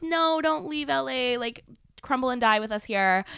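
high, drawn-out mock pleading; mock fit. Friends begging speaker to stay in LA, mock fit. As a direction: wail it slowly like clingy friends, in mockery. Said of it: "they throw a fit".

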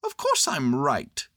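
patiently sure of himself; high little bear voice; matter-of-fact Pooh. A narrator's reading. Pooh's voice.

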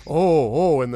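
loud, high, swooping oohs; impressed crowd oohing. Acted. Goblins react to the boss's fancy word, loudly impressed. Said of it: "they're all like".

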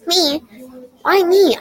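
can't believe it's for him; astounded. A narrator's reading. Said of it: "I said, astounded".

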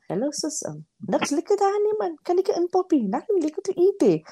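high-pitched imitation of a joking brother; teasing high-voiced mimicry; Wesley. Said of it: "what he loved doing was".

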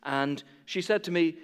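normal talking voice

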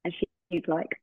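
ordinary talk, nothing marked

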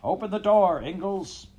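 high, loud shouted demand; called out. A narrator's reading. Man in the crowd calling Pa out, loud high shout. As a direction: bang out the demand like a man in a mob. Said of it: "one called".

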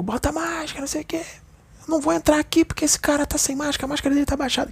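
high, squeaky imitation of an angry stranger yelling; mock angry stranger. A stranger scolding someone about masks, imitated high and shrill. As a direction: ham up the mask-policing busybody.

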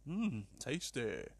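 slow, soft pretend relish; hushed mock-appreciative murmur; mock savouring. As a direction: savour it quietly, as a joke. Mock savouring in imagined quote.

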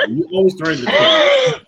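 high, loud teasing yell; shouting. Real, not acted. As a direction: yell it out in mock outrage. Teasing Tyler, laughing shout.

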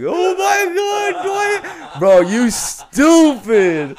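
high, loud, stretched-out mock yell; excited yell. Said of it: "He's like".

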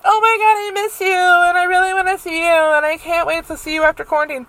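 high, syrupy fake-friend voice; mock gushing friend. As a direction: parody a friend who gushes but never shows.

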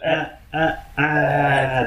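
slow, drawn-out baby cry imitation with two little starts; imitating a baby's warm-up cry. Dad imitating his daughter's pre-cry tuning, slow baby wail. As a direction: stretch the last ah like a baby tuning up. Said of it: "三回ちょっと喉のチューニングが入るんだよね".

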